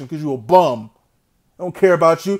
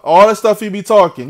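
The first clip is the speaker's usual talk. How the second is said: ordinary talk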